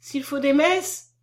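high, slow anxious offer; growing fearful. A narrator's reading. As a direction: draw it out in rising fear. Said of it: "d'une voix qui devenait tremblante".

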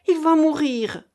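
high, loud alarm; alarmed cry. A narrator's reading. Keinec sees that Raphaël will die, alarmed cry. Alarm over a dying man.

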